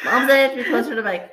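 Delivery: loud, high, laughing announcement; laughing aside. Real, not acted. Co-host joking about being closer to the mic, laughing.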